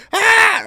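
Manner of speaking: put-on a sudden high, loud scream of a frightened kid; retold scream. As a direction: let out one sharp, very high yell, as if being exorcised. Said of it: "I'm screaming and hollering like".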